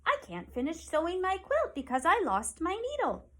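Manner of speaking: put-on old woman voice, a little distressed. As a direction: explain it like a fussing old woman. Old woman explains lost needle.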